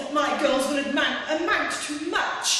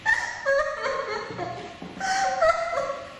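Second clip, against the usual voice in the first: long, high gloating cackle; villainous cackle; 9 semitones higher than usual. Acted. Stepmother exits to the ball.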